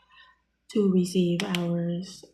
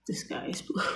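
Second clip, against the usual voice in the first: mocking whisper; 10 dB quieter, faster than usual. Mocking his gullibility.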